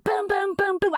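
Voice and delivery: high, sharp boom boom like fists on a door; mock banging on the door. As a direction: pound out the knocks with your voice. Imitating knocking again.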